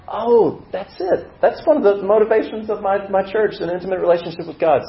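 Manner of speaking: high-pitched eager discovery; excited realisation. Acted. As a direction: pitch leaps up in acted delight, then eases down. Acts out a thrilled realisation.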